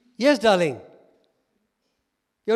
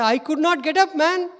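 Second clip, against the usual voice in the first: high-pitched helpless complaint; exasperated protest; 9 semitones higher, 4 dB louder than usual. Retold or imitated. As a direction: plead your case, playfully exaggerated. Boy protests he was trapped.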